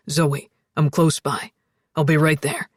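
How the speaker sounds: low, steady man's voice; calm her in a low voice; deep, steady reassurance; reassuring Alex. A narrator's reading.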